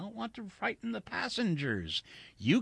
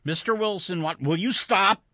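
higher, louder protest; exasperated demand; 8 semitones higher, 8 dB louder than usual. A narrator's reading. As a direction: cut him off, out of patience. Pilot demands that Wilson stop.

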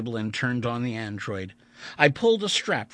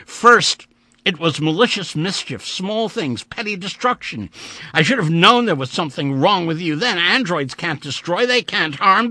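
agitated rant; 7 semitones higher, 8 dB louder than usual. A narrator's reading. Vandeleur rants at the android.

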